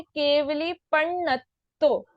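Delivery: पण्णत्तो with a held double ण; पण्णत्तो said carefully, both ण sounded; doubled ण. Shown as a demonstration. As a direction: lean on the half ण before the full ण in पण्णत्तो. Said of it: "एक आधा ण और एक पूरा ण".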